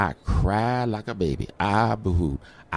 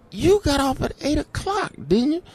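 high incredulous question; high, amazed challenge; surprised. Retold or imitated. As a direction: pitch it way up in disbelief. Surprised nurse still there.

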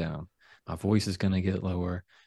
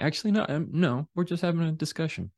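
higher, sheepish backing off; 9 semitones higher than usual. Retold or imitated. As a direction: back down with a higher voice. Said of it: "them saying".

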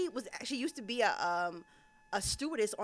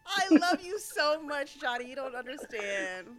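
happy tears; 5 semitones higher, 5 dB louder than usual. Real, not acted. Emotional thanks to guest.

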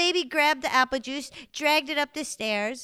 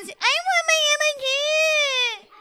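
high, slow crying whine; whiny baby crying; 3 dB louder, slower than usual. Acted. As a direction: sob it like a toddler wanting juice. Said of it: "Everything the baby said was a whine".

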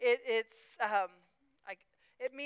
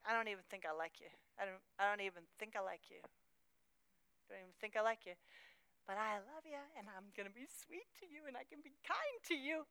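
quiet inner voice; 9 dB quieter than usual. Acted. Inner voice to hurtful person.

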